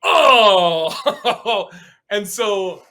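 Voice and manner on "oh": a high, loud, stunned "Oh"; imitated shocked gasp. Mimicking the shocked crowd, high and loud. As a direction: play the whole room gasping at once. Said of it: "the whole audience audibly just go".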